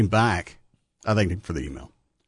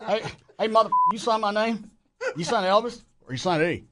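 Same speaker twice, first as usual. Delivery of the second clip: laughing mock [name]; 5 semitones higher, 3 dB quieter than usual.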